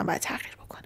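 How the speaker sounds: fast whispered reminder; whispered aside. Real, not acted. Teacher whispering a grammar tip to students. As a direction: slip the note in as a quick whisper.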